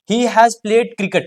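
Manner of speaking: slow, word by word dictation. Shown as a demonstration. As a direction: read it out slowly for notes. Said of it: "teacher dictates example slowly".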